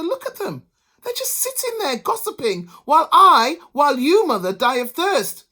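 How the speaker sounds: high, loud complaint; indignant husband. A narrator's reading. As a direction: whine to your mother.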